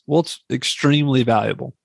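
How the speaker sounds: ordinary talk, nothing marked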